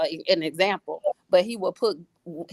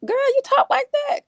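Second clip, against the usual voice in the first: much higher, astonished question; amazed at the baby. Retold or imitated. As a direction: squeal in delighted disbelief at her toddler talking. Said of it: "I was looking like".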